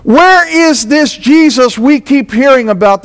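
preacher building up over the resurrection; fervent preaching. Acted. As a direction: press the point home to the congregation. Preaching at full force.

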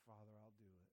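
a son's simple surrender; quiet resolve. Retold or imitated. Son answering the Father, quiet resolve. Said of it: "He said".

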